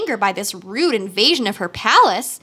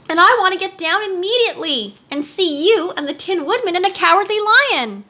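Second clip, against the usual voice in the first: high-pitched, eager demand; urgent wish in a higher voice; girl Dorothy, insistent; 8 semitones higher than usual. A narrator's reading.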